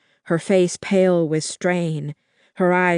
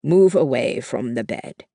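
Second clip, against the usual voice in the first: stern command; 4 semitones lower than usual. A narrator's reading. Armed man orders the stranger.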